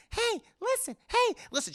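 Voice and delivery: very high nagging helper voice; Navi. Retold or imitated. Streamer mimicking a pestering game helper, high-pitched nagging. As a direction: repeat it high and insistent. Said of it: "from Navi".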